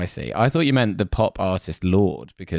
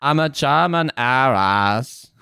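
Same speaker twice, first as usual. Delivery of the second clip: raised, drawn-out bad German accent; Anna Delvey; 5 semitones higher, much slower than usual. Fake heiress stating her pedigree, Anna Delvey accent. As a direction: stretch it out as a hammy German heiress. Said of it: "That is how she talks".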